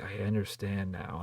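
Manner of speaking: soft, slow last gasp line; mock dying word. Mother's last words on the ground, soft slow mock dying. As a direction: fade out like a dying villain. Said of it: "her dying word".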